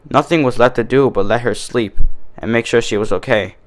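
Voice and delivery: usual speaking voice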